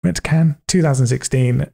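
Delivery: usual speaking voice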